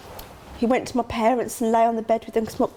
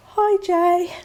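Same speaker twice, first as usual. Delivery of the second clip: high, louder, breezy hello; fake casual greeting; 10 semitones higher, 5 dB louder than usual. Worried mother texting her son's friend, mock breezy high hello. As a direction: sound overly casual to hide worry. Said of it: "and I'd literally like".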